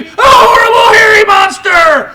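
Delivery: very high, very loud frightened shriek; screaming farmer's wife; terrified scream. A narrator's reading. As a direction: shriek at the monster in the window. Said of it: "she screamed".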